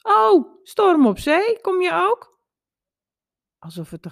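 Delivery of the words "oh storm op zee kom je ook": put-on high, loud child's singsong; cheerful innocent little voice. A narrator's reading. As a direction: call down merrily like a carefree child. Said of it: "een vrolijk en onschuldig stemmetje".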